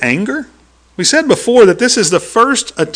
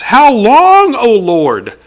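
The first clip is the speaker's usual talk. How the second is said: pleading cry; 6 semitones higher, 4 dB louder, much slower than usual. Retold or imitated. Prophet crying to God.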